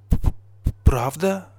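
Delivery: hesitant stutter on the first sound, rising question; stammering. A narrator's reading. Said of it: "заикаясь".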